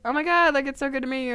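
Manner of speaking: high-pitched, polite gush; imitating an overexcited hello; gushing greeting. New roommate meeting her roommate, gushing high.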